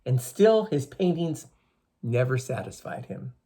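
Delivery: slow, expressive storyteller voice; story reading for children. A narrator's reading. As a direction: read the legend to the class, drawn out. Teacher reading legend to children.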